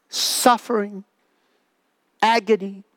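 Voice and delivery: usual speaking voice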